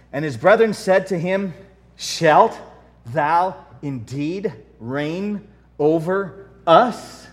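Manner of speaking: loud, solemn King James reading; scripture reading. Acted. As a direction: read it out like Bible verse.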